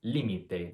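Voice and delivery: Límite stressed as LI-mi-te; first-syllable stress. Shown as a demonstration. Teacher giving the noun for limit, Límite as LI-mi-te. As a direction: hit LI at the start of Límite. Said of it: "that's the noun for limit".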